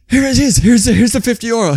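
high, loud, laughing relief; relieved and excited. Retold or imitated. Joe handing the landlord the fifty, relieved and giddy. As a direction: thrust the money at him gladly.

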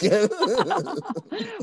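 high rising laugh. Real, not acted. Host laughing at his younger self, high laugh. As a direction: let out a giggle.

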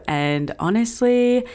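ordinary talk

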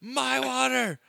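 put-on high, loud little-kid cry of "My water!"; toddler yelling. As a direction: yell it like a two-year-old. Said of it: "she's just yelling at them".

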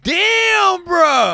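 high, loud amazed yell; amazed shout. Real, not acted. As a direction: blurt out amazement. Amazed at the noise.

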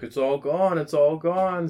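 rising shout, it's all gone; mock panic yell building up; retold yell. Friend yelling as funnel drains.

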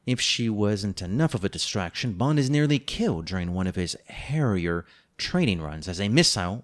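ordinary delivery

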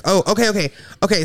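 usual speaking voice

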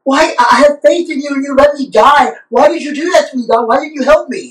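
high, loud indignant why; mad complaint to God. Retold or imitated. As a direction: demand answers, aggrieved. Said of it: "he's mad".

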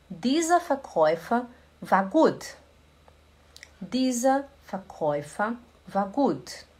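slow, clear teacher read of Dieser Verkäufer war gut; slow model sentence. Shown as a demonstration. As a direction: spell each word out for learners. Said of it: "slow model sentence for students".

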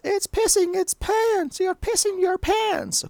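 put-on very high, slow American little-girl taunt; drawn-out mock American brat squeal; American accent. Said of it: "Go into American accent".

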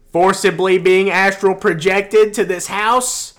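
loud, rising, insistent proclamation; mounting mock outrage. Dreamer pitching his cult theory, loud hammed-up climax.

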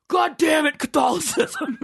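high, shouted comic devil curse; devil fed up. Acted. Devil calling up about crowded hell, exasperated. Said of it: "He's like, Hey".

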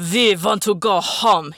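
loud bellow from the chest. Acted. Arkady screaming at the sky, loud bellow. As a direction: roar it at the sky. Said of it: "he bellowed".

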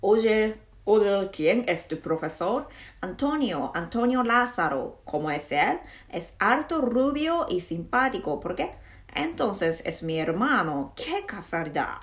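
projected, slow Spanish reading; full-voiced, swooping Spanish recitation; voice from the belly. Shown as a demonstration. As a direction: push the textbook dialogue out from the diaphragm. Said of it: "もうちょっと腹から声出してみようかな".

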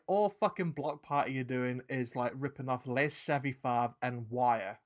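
usual speaking voice

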